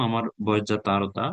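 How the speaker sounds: ordinary talk, nothing marked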